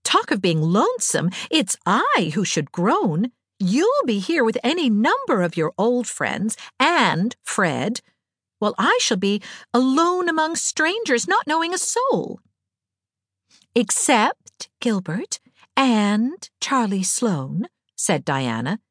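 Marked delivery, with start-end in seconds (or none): 0.0-12.4 put-on high, emphatic girlish lament; Anne, dramatic girl. A narrator's reading. Anne to Diana on who should groan, dramatically plaintive. 13.7-17.7 slow, higher, heavily italicized names; sly teasing. A narrator's reading. Diana mimicking her friend's italics, slyly teasing. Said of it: "imitating Anne's italics and slyness".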